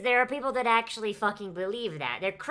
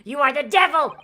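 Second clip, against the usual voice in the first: building to a loud, higher furious cry; accusing outburst; 3 semitones higher, 8 dB louder than usual. Acted. Pavlova accusing the intruders, building to a furious shout. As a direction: hurl the accusation, rising to a shout.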